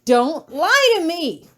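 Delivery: shrill, loud shriek. Acted. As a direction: shriek it, high and piercing. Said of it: "shrill and shrieking".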